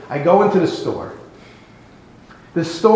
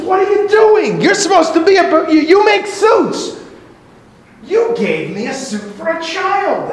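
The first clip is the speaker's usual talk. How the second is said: much higher, louder furious outburst; furious villager; 13 semitones higher, 3 dB louder than usual. Acted. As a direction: rage at the tailor over a ruined suit. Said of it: "and he's furious".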